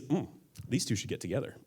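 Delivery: low, soft approving murmur; quiet, low aside to yourself; hushed inner thought. Acted. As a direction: think it aloud under your breath. Voices the reader's approving thought.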